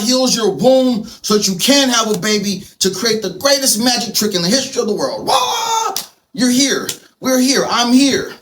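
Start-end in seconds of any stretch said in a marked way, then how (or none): 5.3-5.9 put-on baby's cry. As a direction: cry out like a baby just born. Newborn baby's first cry.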